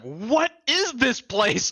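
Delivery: high, stretched incredulous outburst; retold bewilderment. Retelling a trip to Louisiana, high bewildered outburst. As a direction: draw it out high in exaggerated disbelief. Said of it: "and I was like".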